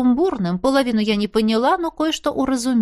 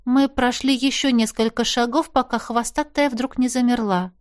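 usual speaking voice

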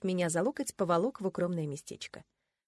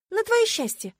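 high, loud retort; snapping back; 7 dB louder than usual. A narrator's reading. Heroine retorting to Marcus's teasing, snapping. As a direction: snap back sharply. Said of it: "огрызнулась я".

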